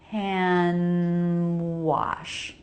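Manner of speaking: "hand wash" linked with no D, as "han wash"; dropped D. Shown as a demonstration. Coach showing learners the dropped D in "hand wash". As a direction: run "hand wash" together, no D sound. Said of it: "just fine to say hand wash".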